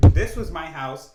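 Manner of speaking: normal talking voice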